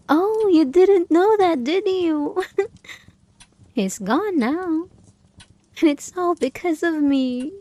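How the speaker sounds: high, loud gloating with a laugh; gleeful taunt. Acted. Doll gloats to owner about grandfather, high gleeful taunt. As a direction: crow over his death, delighted.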